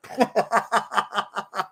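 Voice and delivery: a high, open burst of laughter; delighted high laugh; real laugh. Real, not acted. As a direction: laugh out at the joke. Laughing at the joke.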